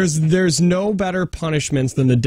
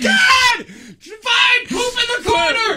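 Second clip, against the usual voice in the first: mock fed-up dad in a very high squeak; dad surrendering to his screaming toddler; exasperated dad, squeaky; much slower than usual. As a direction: give up in a shrill, comic falsetto. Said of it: "And I was like".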